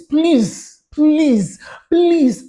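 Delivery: high, loud repeated plea; emphatic pleading. Real, not acted. Vlogger begging viewers to listen, emphatic pleading. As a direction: beg them urgently, each please standing alone. Urging viewers after quoting their questions.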